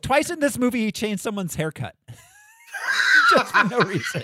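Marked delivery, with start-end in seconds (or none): 2.1-4.2 loud laughing outburst. Real, not acted. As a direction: laugh it out loudly. Bursting out laughing mid-sentence.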